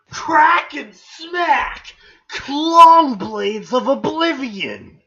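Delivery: slow, loud, high proclamation; hammed-up legendary weapon reveal; dramatic announcer. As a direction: draw each word out grandly. Reads weapon names dramatically.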